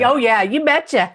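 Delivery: light Minnesota "Oh, yeah, you betcha"; Minnesota accent. Retold or imitated. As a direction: answer back in a mild Minnesota accent. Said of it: "That's perfect".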